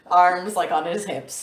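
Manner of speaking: ordinary talk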